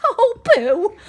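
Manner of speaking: laughing, high and loud; mouse laughing. Acted. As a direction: scoff with a laugh. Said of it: "A mouse laughed".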